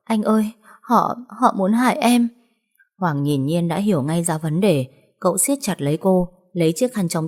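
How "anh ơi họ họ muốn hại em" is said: sobbing. Acted. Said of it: "Cô khóc nức nở".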